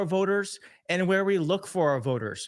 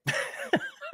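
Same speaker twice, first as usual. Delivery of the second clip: high little laugh; self-conscious laugh; 4 dB quieter than usual. Real, not acted. Host laughing off his emotional moment. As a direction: laugh at yourself.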